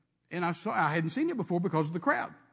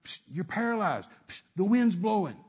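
devil whispering. Retold or imitated. Devil on the shoulder murmuring lies, lightly voiced. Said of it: "the devil sitting up here saying".

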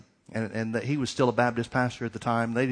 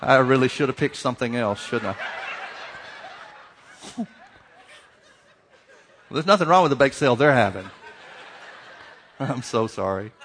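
louder, laughing sheepish backtrack; laughing apology; 5 dB louder than usual. Real, not acted. Preacher apologizing to the congregation for his bake-sale jab, laughing. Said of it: "I'm sorry".

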